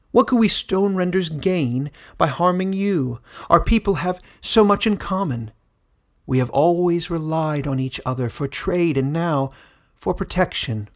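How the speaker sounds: a ruler winning over wary villagers; persuasive queen. A narrator's reading. Verlisden pleads the alliance.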